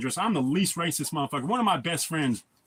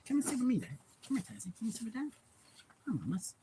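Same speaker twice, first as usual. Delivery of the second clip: pet talk; 5 semitones higher, 9 dB quieter, faster than usual. Real, not acted. Calling his dog Tazzy to sit with him, soft coaxing. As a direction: coax the dog over gently.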